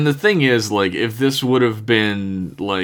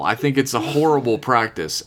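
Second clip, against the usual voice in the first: usual speaking voice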